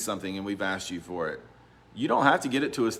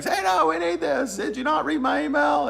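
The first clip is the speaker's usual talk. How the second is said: mock frantic demand, much higher and louder; exasperated colleagues; 5 dB louder than usual. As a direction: squeal it like panicking agents. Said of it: "everyone's saying".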